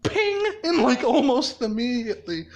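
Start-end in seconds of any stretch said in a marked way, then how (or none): 0.0-0.4 bright-pitched metallic "ping"; high imitated ping. Penny hitting Hal Jordan's ship, high pinging noise. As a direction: pop out a high ping like a coin strike.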